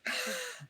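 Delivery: high startled gasp; mock dramatic gasp. John Cusack in the hospital, mock gasp. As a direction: gasp like a triumphant hero. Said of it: "John Cusack being like".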